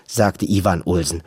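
ordinary delivery

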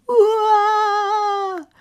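high loud wailing cry; a toddler's howling wail; baby wail. A narrator's reading. Little Tiki Taka bawling in the kitchen, loud wail. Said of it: "Tiki Taka weint".

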